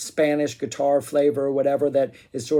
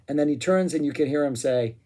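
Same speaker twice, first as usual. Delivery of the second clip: ordinary delivery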